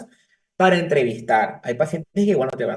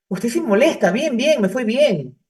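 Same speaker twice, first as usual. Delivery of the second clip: put-on annoyed teen; 6 semitones higher than usual. Son answers mother annoyed.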